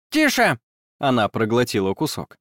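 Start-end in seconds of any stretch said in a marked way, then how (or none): 0.1-0.6 high, impatient tiny fairy; squeaky little fairy, in a hurry. A narrator's reading. Fairy character voice.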